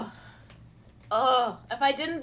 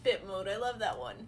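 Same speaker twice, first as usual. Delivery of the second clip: ordinary talk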